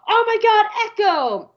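high, loud excited squeal; thrilled fan spotting a favorite; very high delighted shout; excited recognition. Retold or imitated. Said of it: "I was like".

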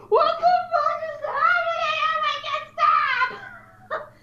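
very high squealed mock panic; mock hysteria. Co-host acting out reading the last third, mock hysterics. Acting out reader's shock at book ending.

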